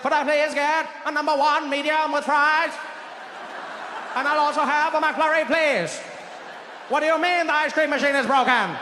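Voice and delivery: high, loud oratory for a fast-food order; JFK. Acted. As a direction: declaim the order like a stretched-vowel speech. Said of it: "ordering food with that voice".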